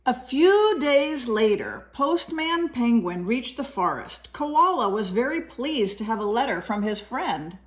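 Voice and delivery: lively storybook reading voice; reading to children. A narrator's reading. Parent reading a picture book to a child, lively storytelling. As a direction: read it brightly to a young listener.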